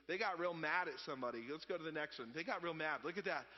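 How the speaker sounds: ordinary delivery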